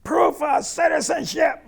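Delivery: loud, high, drawn-out yell; screamed demand. Retold or imitated. ICE officer yelling at driver through the window, screamed demand. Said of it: "he yells out".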